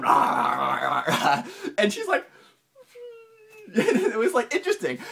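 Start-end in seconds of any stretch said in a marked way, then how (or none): 0.0-1.4 low, loud gruff rah-rah growl; mock angry ranting. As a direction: mimic a man ranting angrily at her. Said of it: "and he's going".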